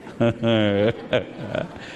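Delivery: a short amused laugh to yourself. Real, not acted. Preacher laughs at story. (risa suave y breve)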